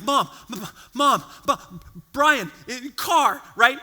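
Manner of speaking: breathless, stammering, broken-off words; panicked stammer. Retold or imitated. Seven-year-old gasping to his mom on the phone, panicked stammer. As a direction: blurt it out in a panic, tripping over every word. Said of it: "I'm out of breath, I'm freaking out".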